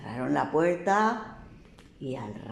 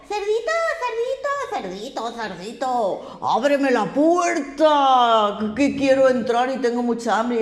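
slow, loud, coaxing big bad wolf; loud slow wolf at the pig's door; wolf calling; 6 semitones higher, 5 dB louder, slower than usual. A narrator's reading. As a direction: drag out each word like a hungry wolf at the door. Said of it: "y dijo".

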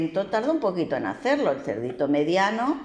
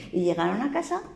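ordinary delivery

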